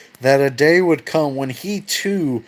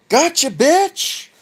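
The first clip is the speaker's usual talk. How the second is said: high, slow gleeful taunt; spring out and gloat; stretched, high mocking gotcha; ambush taunt; 13 semitones higher, slower than usual. Kronos ambushes his father.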